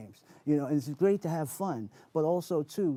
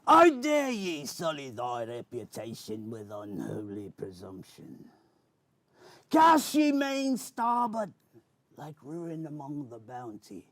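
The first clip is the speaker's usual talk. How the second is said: Captain Barbossa pirate voice; 4 semitones higher than usual. Acted. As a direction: declaim like a swashbuckling pirate. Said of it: "characters was Captain Barbosa".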